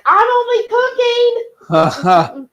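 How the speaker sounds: high, slow, drawn-out holler; mock yelling. Reading viewer's yell at smoke detector, high mock holler. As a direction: holler it in mock protest at an alarm. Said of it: "she yells at it".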